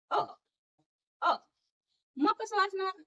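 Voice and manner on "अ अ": "अ" as the short vowel in cut; short U sound. Shown as a demonstration. Teacher showing how U sounds, isolated "अ". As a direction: repeat "अ" as separate short sounds. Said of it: "याचा उच्चार होतो फक्त अ".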